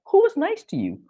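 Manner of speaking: high-pitched incredulous question; mock disbelief. French locals hearing praise of France, incredulous. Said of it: "the look on their faces".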